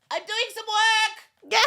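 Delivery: put-on little girl voice. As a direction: chirp it like an innocent four-year-old. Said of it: "four-year-old daughter go".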